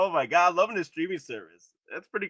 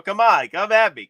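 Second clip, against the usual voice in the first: mock challenge; 6 semitones higher, 8 dB louder than usual.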